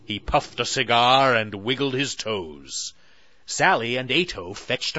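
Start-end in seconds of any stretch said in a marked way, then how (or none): none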